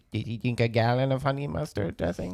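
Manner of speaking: put-on doctor's voice, delivering a deadpan challenge; Doctor Now impression. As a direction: mimic a blunt TV doctor questioning a patient. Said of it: "That's exactly how he talks".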